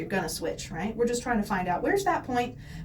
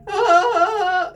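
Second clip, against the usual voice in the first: cracky voice; 7 semitones higher, 9 dB louder than usual. Shown as a demonstration. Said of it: "it's all cracky and stuff".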